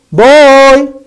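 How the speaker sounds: put-on loud, high, held "Boy"; shouted demo. Shown as a demonstration. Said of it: "even if you shout".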